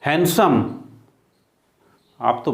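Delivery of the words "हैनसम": हैंडसम as "han-sum"; silent D. Shown as a demonstration. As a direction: drop the d in हैंडसम. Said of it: "यहाँ भी डी silent है". (हैंडसम में डी silent)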